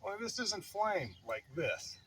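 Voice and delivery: ordinary talk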